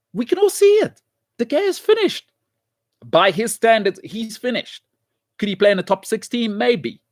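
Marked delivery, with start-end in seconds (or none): 0.1-1.0 "We can all see it." jumped much higher, exasperated; "We can all see it." squeaked high with frustration; exasperated high repeat. Real, not acted. 1.4-2.3 much higher, worked-up exasperation; exasperated pitch jump; exasperated outburst. Real, not acted.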